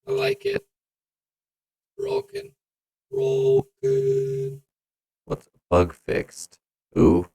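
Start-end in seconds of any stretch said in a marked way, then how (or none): 0.1-4.6 chipmunk voice. Pretend. High pitch effect on.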